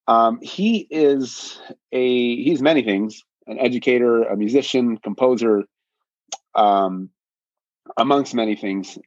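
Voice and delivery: usual speaking voice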